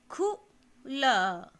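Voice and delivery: पुल drawn out slowly and loud, each sound clear; slow syllabic reading. Shown as a demonstration. Teacher reads पुल slowly, loud and stretched. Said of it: "अक्षर को मात्रा के साथ आप पढ़ेंगे".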